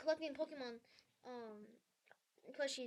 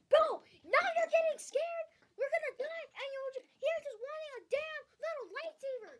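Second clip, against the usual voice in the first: loud, high-pitched incredulous rant; exasperated yelling; 11 semitones higher, 8 dB louder than usual. Retold or imitated. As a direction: yell at a clueless friend, voice pitched way up. Retold yelling at friend under gunfire.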